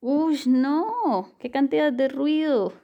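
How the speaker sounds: high, loud startled cry over the din; startled by noise. Acted. Host jolted by a loud recording demo, startled and loud. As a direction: react as if blasted by noise.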